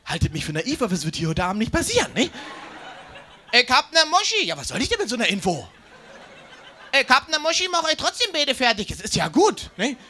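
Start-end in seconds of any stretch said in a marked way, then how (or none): 3.5-4.5 mock bar guy. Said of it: "repeating the aggressive guy". 6.9-9.7 mock bar guy. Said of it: "repeating the aggressive guy".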